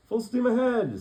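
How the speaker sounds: high, loud order; commanding call. Acted. Jonathan shouting orders to the goat, high and loud. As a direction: call it out like a proud skipper.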